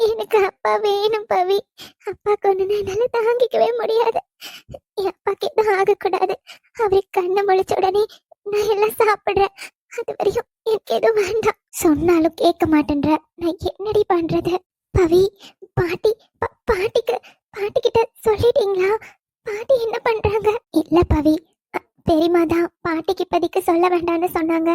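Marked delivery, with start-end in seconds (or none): 0.1-11.6 tearful daughter pleading for her father; crying. Acted. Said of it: "தண்ணி கூட குடிக்காம அழுதுகிட்டே இருக்க". 15.8-20.5 halting, higher-pitched crying voice; tearful questioning. Acted. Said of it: "அழுதுகிட்டே இருக்க".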